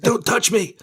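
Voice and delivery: high, loud mock snap; mock Baldwin. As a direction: bark it like an angry star. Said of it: "He's like".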